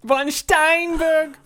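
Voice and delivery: high, slow, loud hammed-up German accent. Doing a German accent on request for his cohost, high and hammed-up. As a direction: bark it like a cartoon German. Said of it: "you got the best German accent".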